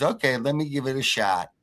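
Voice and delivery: lightly voiced little old man; a hint of an old man stepping up; mild old-man voice, modest offer.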